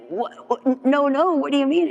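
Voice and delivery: high, stammering protest; acted disbelief. Sponsee objects to sponsor's refusal, high-pitched disbelief. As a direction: splutter it out high, imitating shocked objection.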